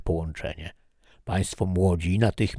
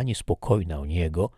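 usual speaking voice

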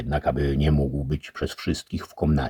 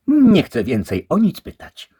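wysoko i głośno, kobiecy głos; obraża się na odpowiedź; urażona dama; 10 dB louder than usual. A narrator's reading. The lady sulks. (dama urażona odpowiedzią)

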